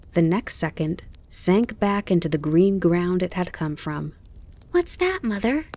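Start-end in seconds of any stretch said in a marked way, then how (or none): 4.7-5.7 put-on high, curious child voice; Bambi. A narrator's reading. Little fawn asking his mother what he sees, curious. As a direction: ask it high and wide-eyed. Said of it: "he asked".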